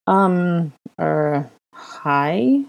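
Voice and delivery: hesitant, halting greeting with a questioning end; uncertain. Acted. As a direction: offer it tentatively. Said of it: "offered uncertainly".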